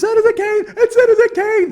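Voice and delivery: very high, loud frantic yell; crazy yelling. Retold or imitated. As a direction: scream it like a madman. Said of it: "That fucking crazy Kevin McDonald yelling".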